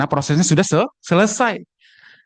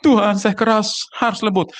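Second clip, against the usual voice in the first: higher, imitated weepy pleading; mock tearful prayer; 5 semitones higher than usual. Dialect speaker praying to God about a hard heart, mock tearful. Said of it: "doanya nangis".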